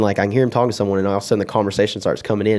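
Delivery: usual speaking voice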